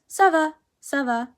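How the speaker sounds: mild back-and-forth of two voices; casual exchange. Shown as a demonstration. Teacher acting out a whole typical conversation. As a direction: toss the greeting and answer off lightly. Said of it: "a typical conversation is".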